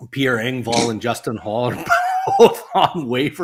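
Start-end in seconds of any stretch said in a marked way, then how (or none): 1.7-3.4 talking through laughter; can't get it out without laughing. Real, not acted. Host sharing waiver news with co-host, laughing.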